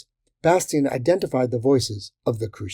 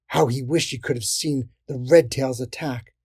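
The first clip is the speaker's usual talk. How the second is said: plain reading voice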